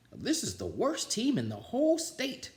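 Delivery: high, put-on voice of a moping principal; a glum school principal complaining; gloomy principal. A narrator's reading. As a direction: voice the principal whining about his team. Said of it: "he said".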